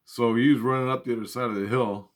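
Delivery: normal talking voice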